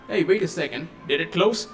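ordinary delivery

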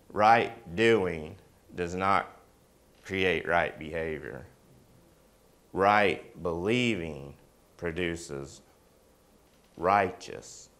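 sermon point spelled out slowly; slow emphatic teaching. Real, not acted. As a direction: lay each word down deliberately. Teaching point, slowed and lowered.

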